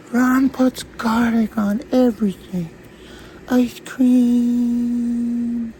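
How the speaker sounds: high, loud, drawn-out delivery; put-on high voice. Mocking Ron's garlic on everything, high put-on voice. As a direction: belt it out high and stretch the last word.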